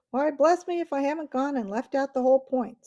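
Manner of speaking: high, loud, blustering Dufflepud chief; pompous little chief, pleased with himself; comic chief voice. A narrator's reading.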